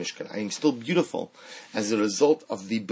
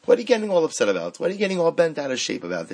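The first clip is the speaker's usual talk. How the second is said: higher, louder, fast reassuring nudge; friend brushing it off; 5 semitones higher, 4 dB louder, faster than usual. Retold or imitated. A good friend telling you to calm down, fast breezy reassurance. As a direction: talk your friend down, quick and light. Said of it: "and they tell you".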